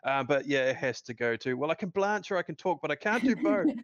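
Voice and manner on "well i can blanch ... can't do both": higher and louder mock-smug delivery; hammed-up Schmidt quote. Co-host quoting his favourite Schmidt line, hammed-up and smug. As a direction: play the line as a smug show-off.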